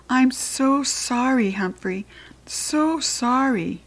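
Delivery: slow, gentle sorry, lifted and drawn out; tender apology. Acted. Girl soothing her scared hamster, slow tender apology.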